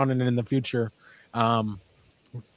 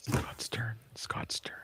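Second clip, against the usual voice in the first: very soft whisper; whispered prompt; 3 semitones lower, 15 dB quieter, much slower than usual. Real, not acted. Co-host prompts the host whose turn is next, whispered. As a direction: whisper a reminder under your breath.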